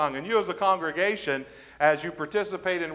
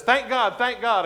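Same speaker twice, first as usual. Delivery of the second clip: higher-pitched repeated command; urging a horse; 6 semitones higher than usual. Retold or imitated. Rider urging his horse to a trot, raised mock call. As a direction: call it twice, pushing the horse on.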